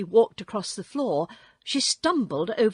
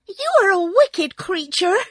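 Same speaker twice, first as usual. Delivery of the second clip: put-on high, loud outraged girl; angry cry; 10 semitones higher, 9 dB louder than usual. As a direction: cry it out in fury. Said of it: "cried Dorothy".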